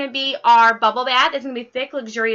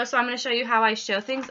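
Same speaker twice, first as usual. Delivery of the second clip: ordinary delivery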